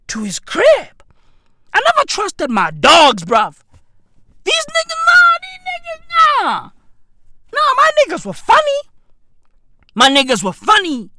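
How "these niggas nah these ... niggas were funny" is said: squeaky mock voice.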